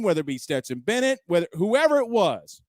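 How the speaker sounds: ordinary talk, nothing marked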